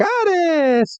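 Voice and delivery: put-on very high, drawn-out baby creature cry; Oddish. Baby Oddish pops out of the grass, high squeaky cry. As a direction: squeak the name out long and high. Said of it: "out a baby Oddish".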